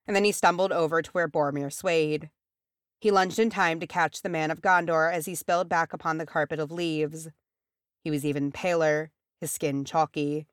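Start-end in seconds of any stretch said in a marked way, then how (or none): none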